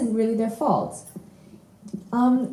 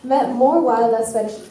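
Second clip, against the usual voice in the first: slow words breaking into a laugh; slower than usual. Real, not acted. Presenter laughing at her own stumble over the list. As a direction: laugh through it after tripping up.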